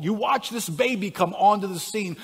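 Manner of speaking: usual speaking voice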